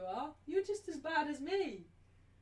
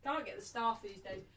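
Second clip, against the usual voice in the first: normal talking voice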